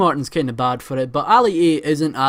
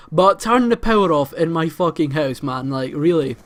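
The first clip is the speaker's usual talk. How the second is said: ordinary delivery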